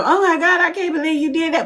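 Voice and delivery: high, mocking fake shock; mock shocked gasp. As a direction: imitate fake surprised bystanders.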